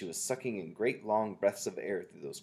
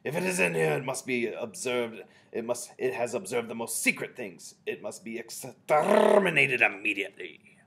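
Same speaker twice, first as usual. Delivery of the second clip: screeched; 4 semitones higher, 9 dB louder than usual. A narrator's reading. Grand High Witch demanding the spy be exterminated, screeched. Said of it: "screeched the Grand High Witch".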